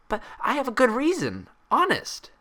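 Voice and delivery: high, bubbly pony voice, puzzled; Pinkie voice. Acted. Pinkie asking Twilight about her hair, high and bubbly. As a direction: bounce up high and curious.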